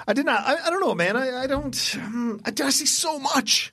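very high, squeaky mock-helpless voice; overwhelmed whine. Admitting to co-host he can't see everything, in a high overwhelmed whine. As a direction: plead helplessly in a tiny, high-pitched whine.

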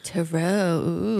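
spooky whispered echo; whispering. Acted. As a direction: breathe the word back. Echoing the mysterious topic.

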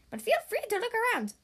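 high, fast little-bird voice; quick bird voice. Acted. Bird invites Big Macs to look around, high fast bird voice. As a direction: chirp it out quickly and kindly.

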